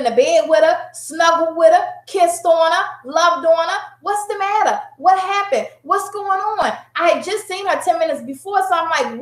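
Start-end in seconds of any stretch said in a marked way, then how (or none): 4.0-6.7 higher-pitched worried questions; gently pressing a child for answers; concerned mom. Retold or imitated. Acts out comforting her crying daughter.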